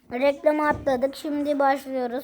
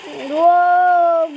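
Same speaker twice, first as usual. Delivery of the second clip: excited wow; 3 semitones higher, 8 dB louder than usual. Real, not acted. Bomb kills many people.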